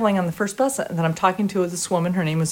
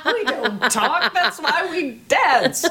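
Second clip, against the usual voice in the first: slow, high, laughing mock protest; 7 semitones higher, slower than usual. Dancer protesting she has to talk, slow laughing. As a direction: laugh through it in mock panic. Mock panic over interview.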